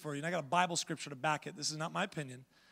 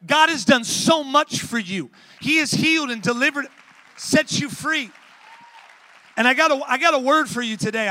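fiery preaching; 6 semitones higher, 12 dB louder than usual. Acted. Preacher urging lazy Christians in the congregation, fiery shout. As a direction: preach it out to the whole room.

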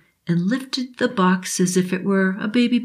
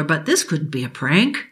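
ordinary delivery

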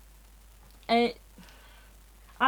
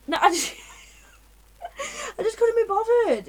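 laughing; 11 semitones higher, 3 dB louder than usual. Real, not acted. Laughing at own joke.

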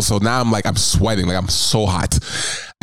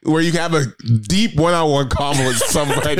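higher, words through laughter; laughing; 5 semitones higher than usual. Real, not acted. Joking with a friend about back rooms, laughing. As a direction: giggle through the warning.

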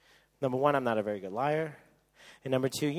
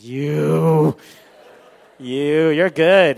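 loud, drawn-out mock accusation; amazed realisation; 4 semitones higher, 15 dB louder, much slower than usual. Girlfriend finds out about surprise party, loudly amazed. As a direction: stretch the first word loudly, impressed.

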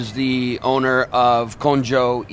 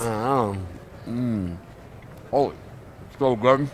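savoring; much slower than usual. Real, not acted. Tasting food, savoring it.

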